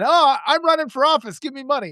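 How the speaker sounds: lightly hammed-up candidate asking for cash; mock plea. Mocking a fundraising text, hammed-up begging.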